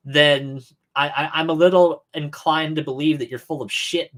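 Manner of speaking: ordinary talk, nothing marked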